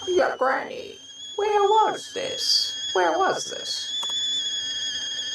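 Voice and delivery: very high, drawn-out questioning; high character voice. Acted. Game character questioning Granny, very high slow voice. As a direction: pitch it way up and stretch the question.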